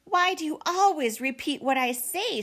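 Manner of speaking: high, irritated sibling bickering; annoyed. A narrator's reading. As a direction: complain at your copycat brother. Sister scolds copycat brother.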